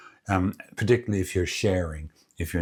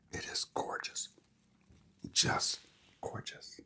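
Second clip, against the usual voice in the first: hushed whisper of delight; 14 dB quieter than usual. Real, not acted. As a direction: breathe it out in a whisper. Hushed praise while eating.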